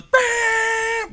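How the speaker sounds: very high imitated horn blare; long, leaned-on vocal honk; hard horn blast. Steaming niece leans on horn, hard imitated blast. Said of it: "hits the horn really hard".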